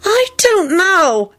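high, slow worried piping. A narrator's reading. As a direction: pipe up anxiously, drawing it out. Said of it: "Esther piped in worriedly".

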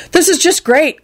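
high-pitched exasperation; furious thought. A narrator's reading. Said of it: "Quentin thought furiously".

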